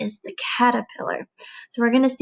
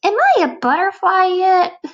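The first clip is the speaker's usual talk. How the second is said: put-on high, loud eager kid voice; a fidgety bug asking too soon; squeaky demanding caterpillar; impatient caterpillar; 8 semitones higher, 6 dB louder than usual.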